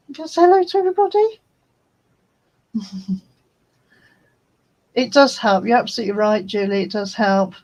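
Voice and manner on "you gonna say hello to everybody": fast, high coaxing voice; pet talk. Real, not acted. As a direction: coax the cat to greet everyone. Baby talk to the cat.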